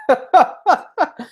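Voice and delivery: a sudden high laugh, falling away. Real, not acted. Vlogger to viewers, bursting into a high laugh. Laughs at his own joke.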